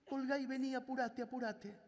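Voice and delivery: high, hurried imitating mother; urgent mother. Mother urging her son to hang up and come, high eager voice. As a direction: rush him home, mock eager.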